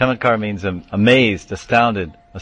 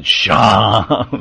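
louder, drawn-out reply from afar; answering call; 5 dB louder than usual. Retold or imitated. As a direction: imitate a devotee calling back, stretched out. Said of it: "someone replied".

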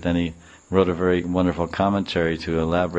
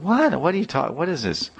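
much higher sudden disbelief; shocked cry; 9 semitones higher than usual. Retold or imitated. Husband accused of hurting the boy, shocked. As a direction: blurt it out in shock.

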